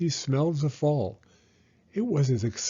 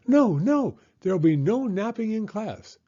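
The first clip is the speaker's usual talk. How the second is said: very high, louder firm bird voice; declaring bird; 8 semitones higher, 5 dB louder than usual. A narrator's reading. Bird teacher forbids napping in class, high and firm. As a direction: lay down the rule sweetly but firmly. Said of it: "declared the bird".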